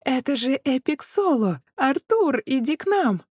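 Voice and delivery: put-on high, loud girlish squealing; excited squeal. A narrator's reading. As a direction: squeal like thrilled fangirls. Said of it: "Возбуждённо визжали женские голоса".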